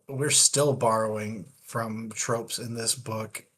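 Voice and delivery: ordinary talk, nothing marked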